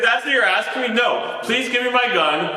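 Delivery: high, laughing mock desperation; hammed-up panic through laughter; laughing mock plea. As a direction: beg for the gun half-laughing. Mock pleading for his gun.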